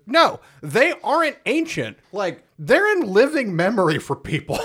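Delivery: high, emphatic protest; mock exasperation. Jack correcting the supposed ancient history, exasperated. Jack's incredulous correction, retold.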